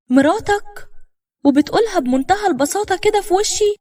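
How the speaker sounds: high-pitched, incredulous echo; shocked outrage. A narrator's reading. Wife reacting to husband's second marriage, shocked outrage. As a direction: throw it back at him in disbelief.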